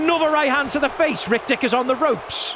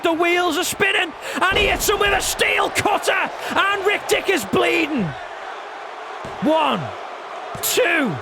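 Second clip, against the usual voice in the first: wrestling commentator; 3 semitones higher than usual. Acted. Live play-by-play.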